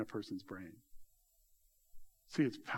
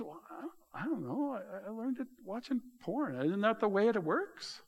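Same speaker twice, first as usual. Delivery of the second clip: naive husband; 9 semitones higher than usual. Retold or imitated. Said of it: "And he goes".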